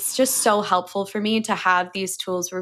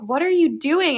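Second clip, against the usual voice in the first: mock annoyed; 8 semitones higher, 3 dB louder than usual. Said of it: "I'm, like, all pissed off".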